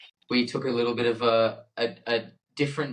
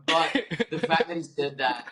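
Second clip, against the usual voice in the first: laughing; 5 semitones higher than usual. Real, not acted. Laughing after the retold quote.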